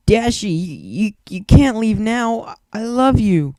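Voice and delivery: tearful plea. A narrator's reading. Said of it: "Pinkie Pie whispered".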